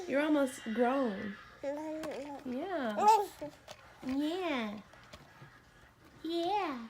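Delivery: put-on high, sing-song talk to a baby; mother coaxing her baby to say bye-bye, sing-song. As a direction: coo at the little one, high and sweet. Talking to baby daughter.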